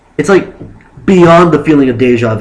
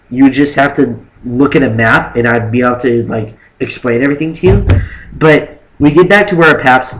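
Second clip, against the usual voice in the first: usual speaking voice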